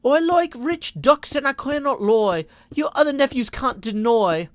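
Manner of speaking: put-on a botched British accent on a rapped chat line. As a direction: try for a British accent and miss it. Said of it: "she would have a British accent".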